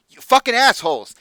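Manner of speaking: loud, high-pitched cursing; angry outburst. Acted. Hothead stepping forward at the clients, furious and loud. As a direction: explode at them.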